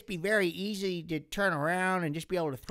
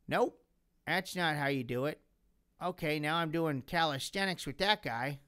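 usual speaking voice

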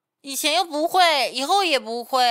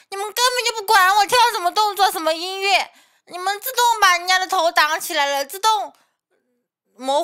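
indignant rant; 6 semitones higher, 5 dB louder than usual. Real, not acted.

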